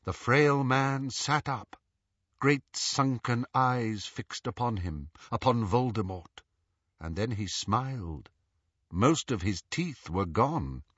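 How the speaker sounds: ordinary delivery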